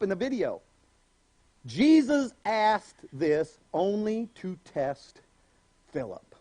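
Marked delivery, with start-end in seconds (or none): none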